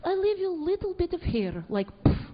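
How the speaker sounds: put-on Russian accent with dismissive puffs; offhand mock Russian accent; Russian lady. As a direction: imitate a matter-of-fact Russian beautician. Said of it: "she goes".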